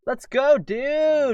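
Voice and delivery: loud, high, drawn-out cheer; rally your buddy with gusto; excited rallying call. Acted. Dad setting off to help his friend, excited high shout.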